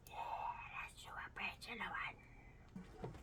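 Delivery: very soft whisper; hushed, barely audible decision. Real, not acted. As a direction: whisper it as an aside. Whispered repeat to herself.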